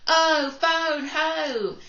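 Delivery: shouted. Shown as a demonstration. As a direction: shout the rhyme out to the class. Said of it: "I hope you were shouting".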